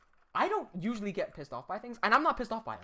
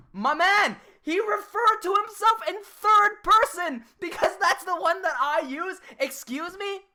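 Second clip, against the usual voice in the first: incredulous; 11 semitones higher, 7 dB louder than usual. Real, not acted. Incredulous mockery of rival's third person.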